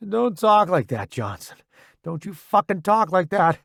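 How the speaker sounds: urgent, upset scolding; fierce insistence. A narrator's reading. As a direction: refuse to let a friend give up. Soldier refuses friend's resignation.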